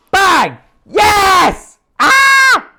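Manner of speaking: triumphant high yells; yelling at a horse race. Real, not acted. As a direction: scream for the win. Trade hits target, celebration.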